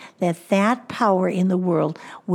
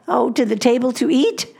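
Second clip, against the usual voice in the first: ordinary delivery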